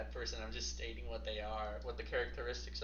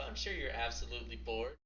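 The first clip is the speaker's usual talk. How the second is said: ordinary talk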